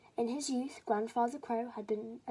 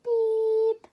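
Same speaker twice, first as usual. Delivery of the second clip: very high, loud piping beep; squeaky beep; 12 semitones higher, 12 dB louder than usual. Acted. Baby crow trying to sing, high beep. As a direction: pipe a tiny beep. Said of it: "and sang".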